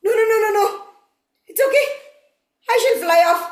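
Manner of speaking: mildly alarmed crow; scared. Acted. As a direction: placate a threat with quick nos. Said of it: "he was angry and he was scared".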